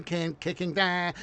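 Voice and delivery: usual speaking voice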